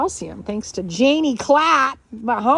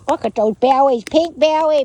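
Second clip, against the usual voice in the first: louder, delighted gush; excited doting; 3 semitones higher, 8 dB louder than usual. Real, not acted. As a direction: gush over the puppies. Doting on puppy bellies.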